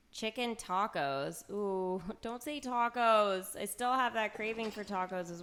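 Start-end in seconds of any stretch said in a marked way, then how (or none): none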